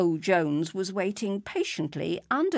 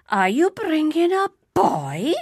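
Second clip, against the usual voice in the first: high, loud, horrified question; an old villager aghast at a child; horrified old man; 10 semitones higher, 8 dB louder than usual. A narrator's reading. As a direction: recoil in horror at the boy. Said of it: "He looked at me with horror".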